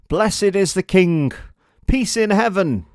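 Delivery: high, loud joyful cheer; crowd shouting. Acted. The crowd hailing the man on the donkey, loud cheer. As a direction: hail the king at the top of your voice. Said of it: "the crowds began to shout".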